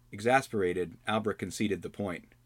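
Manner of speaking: plain reading voice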